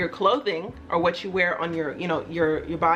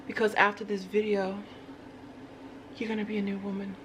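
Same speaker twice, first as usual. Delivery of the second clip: put-on accented alter ego; 6 dB quieter than usual. Alter ego coaxing viewers to become a new woman, accented persona voice. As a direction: play the accented alter ego, soft and unhurried with long pauses. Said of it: "is always in a different accent".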